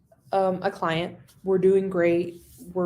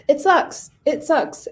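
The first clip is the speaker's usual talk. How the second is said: higher-pitched, emphatic exasperation; exasperated venting; 7 semitones higher, 5 dB louder, slower than usual. Real, not acted. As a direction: vent it, pushed up high. Venting about lost client.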